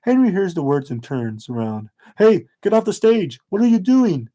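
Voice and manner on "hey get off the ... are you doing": alarmed shout. Acted. Henry shouts at bullies on stage.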